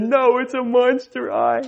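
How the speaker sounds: put-on high, slow whiny child voice; insistent little boy. Son contradicts dad about the smoke detector, whiny child voice. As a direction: imitate a toddler stubbornly correcting a grown-up. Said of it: "And he said".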